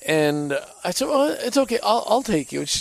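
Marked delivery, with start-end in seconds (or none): 1.1-2.6 eager offer. Retold or imitated. Insisting on driving his wife.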